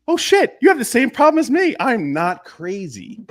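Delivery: a hammed-up "oh no, me too" moment; mock shocked relief. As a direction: act like a business owner suddenly realizing they're not alone. Entrepreneurs realizing shared problem.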